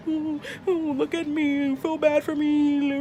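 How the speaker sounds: high, slow, whimpering pity-me voice; mock pitiful whine. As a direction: mimic her fishing for sympathy with a fake whimper. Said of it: "all pitiful looking".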